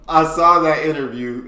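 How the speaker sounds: loud excited blurt; excited exclaim. Real, not acted. Recalling the interview, loud excited blurt. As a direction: jump in excited.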